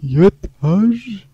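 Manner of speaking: "Yeter" cried out high and loud, falling; anguished shout. Real, not acted. Gamer cries out "Yeter" after dying again. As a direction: wail "Yeter" like you're breaking. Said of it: "ağlasam mı, ağlamasam mı".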